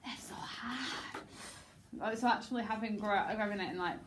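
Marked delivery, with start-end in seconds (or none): 0.0-1.2 soft, long breathy groan on hard; lamenting line reading. Acted. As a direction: let the line sink into a drawn-out whisper. Director demonstrating the line with action.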